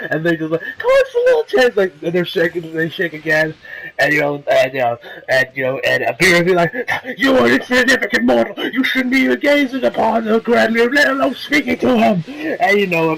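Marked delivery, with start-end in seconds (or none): 0.6-1.8 very high wheedling imitation; mock pleading. Goku pleads with the bodyguards, imitated high wheedling. 7.2-12.2 higher, haughty mock reprimand; indignant scolding. Beerus scolds Goku for approaching the Grand Leader, imitated indignation.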